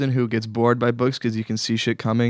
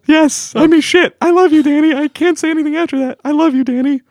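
high falsetto woman's voice; man doing a woman's voice; 12 semitones higher, 5 dB louder than usual. Pretend. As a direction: squeak it out like a girlfriend on the phone. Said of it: "Do you like my girl voice?".

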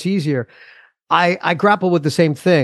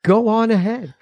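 cool parent; 7 semitones higher than usual. Retold or imitated.

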